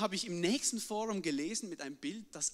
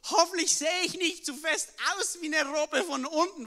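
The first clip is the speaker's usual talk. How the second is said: higher, louder anxious thought; panicked thought; 7 semitones higher, 6 dB louder than usual. Retold or imitated. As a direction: worry out loud in panic. Panicked paddling, fearing shark.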